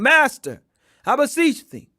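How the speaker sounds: usual speaking voice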